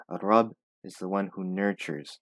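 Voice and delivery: ordinary talk, nothing marked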